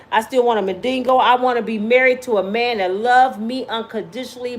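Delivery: ordinary delivery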